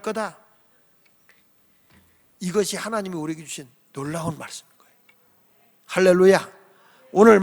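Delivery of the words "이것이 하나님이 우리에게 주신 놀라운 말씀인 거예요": whisper. Real, not acted. Sudden hush after shouting.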